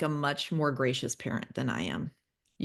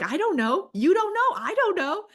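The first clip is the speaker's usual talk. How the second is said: high, helpless, rising panic; exasperated; 4 dB louder than usual. Retold or imitated. New mom answering the midwife, high helpless exasperation. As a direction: throw up your hands, squeaky and lost.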